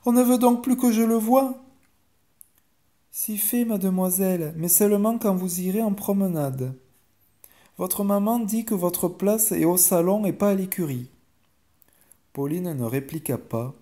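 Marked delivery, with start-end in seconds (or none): 0.1-1.6 put-on high, loud child's protest; distressed girl, voice raised high; anguished cry. A narrator's reading. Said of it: "s'écria Pauline". 3.2-11.1 higher-pitched servant voice, measured; formal maid, pitched high; chambermaid delivering orders. Acted.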